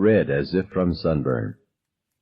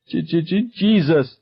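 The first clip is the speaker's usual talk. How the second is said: stammered scream; 10 semitones higher than usual. A narrator's reading. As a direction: stutter up into a scream. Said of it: "she screamed".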